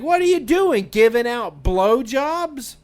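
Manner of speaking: high, loud burst, drawn out at the end; mock boisterous shout. Kid busting in on a birthday party, loud mock shout. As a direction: blurt it out loudly like an oblivious kid. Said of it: "he just busts in the door".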